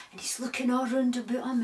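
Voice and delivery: plain reading voice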